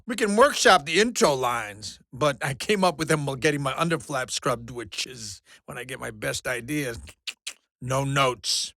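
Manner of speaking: ordinary talk, nothing marked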